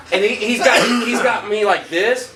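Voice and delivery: normal talking voice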